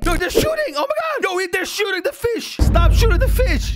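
high alarmed yelling at them; alarmed shouting. Real, not acted. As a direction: yell at them to stop. His prank foiled, fish shot.

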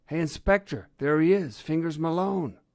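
high, loud alert; excited calling out. A narrator's reading. Fox spotting the thief at the park, calling excitedly. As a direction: call across to a partner, urgently.

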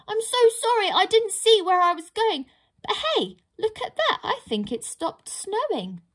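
high, quick apologetic pixie voice; a tiny pixie babbling sorry with a nervous laugh; flustered pixie. Acted. Pixie blurts apologies to the huge troll, high and flustered.

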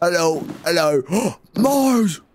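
high excited exclamation. Real, not acted. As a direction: call out in delight. Excited shout of a name.

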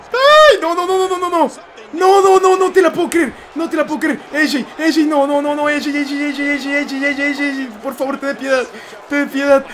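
high, loud, frantic no no no as the move goes wrong; tense panicked pleading. Real, not acted. Gamer pleading with his opponent in the match, frantic high panic. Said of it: "qué tensión que tengo".

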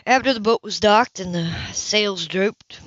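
plain reading voice

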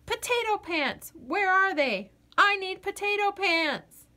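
high panicked yelling; frantic shout. Acted. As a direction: shout searching desperately. Potato bursts in searching.

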